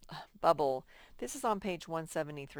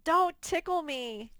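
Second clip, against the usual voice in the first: playful mock plea; 10 semitones higher, 5 dB louder than usual. Playful mock plea, then laughs.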